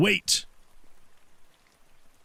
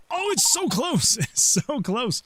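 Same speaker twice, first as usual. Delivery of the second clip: excited; 8 semitones higher, slower than usual. Real, not acted. Near miss in game.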